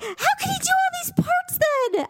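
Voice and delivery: very high, incredulous kid imitation; shocked protest. Daughter disputing Mom's film trivia, high mock shock. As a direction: squeak it out in mock disbelief.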